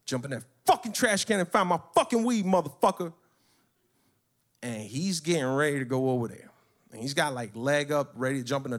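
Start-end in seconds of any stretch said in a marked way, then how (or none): 0.1-3.1 acted angry order, pitched up; retold furious demand. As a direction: hammer out the command, punching each curse.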